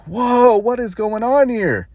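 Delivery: high, drawn-out mock astonishment; wonder-struck imitation, slow and high; amazed reaction. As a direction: stretch it out high, imitating stunned fascination. Acting fascinated Aloy seeing births.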